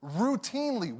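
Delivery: normal talking voice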